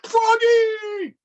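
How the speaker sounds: high, loud yell for Froggy; calling out loud. A narrator's reading. Father calls son awake, loud high shout. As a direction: shout it across the house. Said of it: "called his father".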